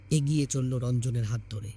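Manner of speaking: ordinary delivery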